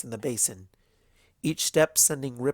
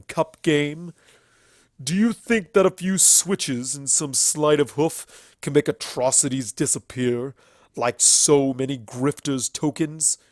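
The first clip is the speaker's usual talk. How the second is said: louder, slow, seething stallion tirade; furious stallion accuser; 8 dB louder than usual. Acted. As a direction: condemn the tyrant with contempt.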